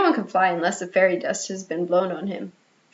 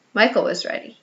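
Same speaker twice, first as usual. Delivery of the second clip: usual speaking voice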